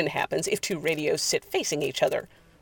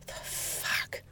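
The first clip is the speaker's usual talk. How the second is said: very soft, voiceless whisper; stunned whisper; 15 dB quieter than usual. Acted. Bodine seeing the figure dissolve, whispering. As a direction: breathe it in disbelief.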